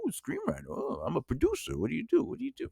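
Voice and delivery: high, hushed mock fascination; intrigued producer. Acted producer suddenly interested in screenwriter.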